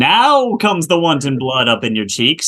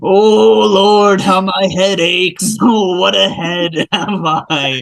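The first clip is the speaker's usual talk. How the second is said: moaning in pain; 4 semitones higher, slower than usual. Acted. Nurse moans of head.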